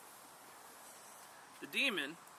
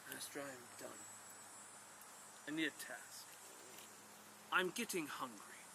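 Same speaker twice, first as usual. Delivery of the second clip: low, soft, menacing giant voice; a towering demon quietly threatening its master; 4 semitones lower, 11 dB quieter than usual. Acted. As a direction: loom over him and speak low and quiet. Giant demon looms over the man.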